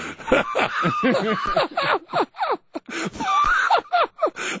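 high shrieking laugh that tips toward crying; big laugh. Real, not acted. Co-host losing it after being called the weasel, shrieking laugh. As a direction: laugh until it squeaks.

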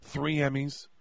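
low, hushed confession; hammed-up solemn heartbreak, pitched low; mock devastation. Mock-solemn confession of award loss.

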